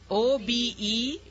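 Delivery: slow letter-by-letter correction; measured teacher's spelling; spelled out. Shown as a demonstration. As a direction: spell it out deliberately, each letter separate. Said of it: "You missed the E sound".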